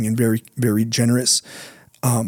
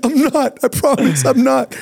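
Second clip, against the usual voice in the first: nervous pleading; 11 semitones higher than usual. Retold or imitated. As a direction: plead with the pastor, voice pinched up high. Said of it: "and I go, I go".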